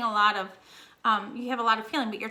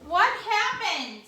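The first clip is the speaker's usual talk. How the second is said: normal talking voice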